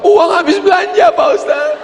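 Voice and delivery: comic whining mother; hammed-up shopping-regret cry; mock lament. Mother explains why she cries.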